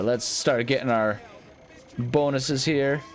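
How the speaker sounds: usual speaking voice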